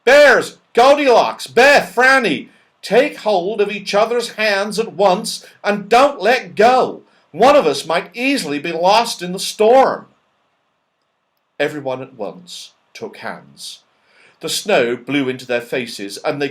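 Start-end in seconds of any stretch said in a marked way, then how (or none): 0.0-10.1 high, loud call of alarm over the storm; urgent, much louder and higher cry. Acted. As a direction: shout to the others in alarm to hold hands. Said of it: "called out in alarm".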